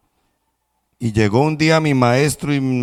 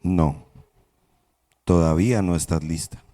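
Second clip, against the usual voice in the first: lower, softer, unhurried master; calm master; 6 semitones lower, 3 dB quieter than usual. Acted. Master calmly tells the cup it's not ready, low and soft. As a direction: calmly deny the cup's plea. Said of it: "mi maestro ... me decía".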